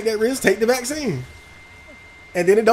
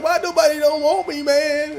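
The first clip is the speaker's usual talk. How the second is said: mimicking whiny plea; 7 semitones higher than usual. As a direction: repeat his sad plea, higher and hushed. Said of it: "I'm mimicking the way he was talking".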